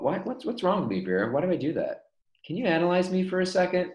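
ordinary talk, nothing marked

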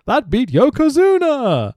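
high, loud mocking shout; hammed-up indignant boss yell; Bischoff impression. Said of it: "Bish being like".

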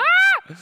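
sudden high, loud mock shriek; short piercing imitation scream; imitated scream. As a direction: shriek like a terrified man, briefly acted out. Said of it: "ze gaan gillen".

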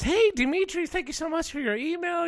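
high, sugary mock sales voice; fake friendly greeting. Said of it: "the classic fake introduction".

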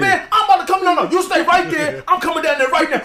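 high, mock-furious threats; real hyped victim. Hyped prank victim threatening to come down, high mock fury. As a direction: fire up as if coming over to fight. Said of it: "they get them real hyped".

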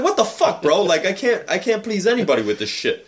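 high, exasperated mock protest; indignant retort. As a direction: throw up your hands in mock outrage. Retold indignant reply to friend.

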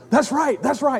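high, eager repeat of approval; light up as you agree; excited affirmation. Retold or imitated. Coach lighting up at player's words, excited.

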